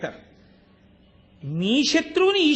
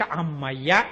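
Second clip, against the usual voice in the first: relief; 9 semitones lower, slower than usual. Retold or imitated. Relief after getting child back.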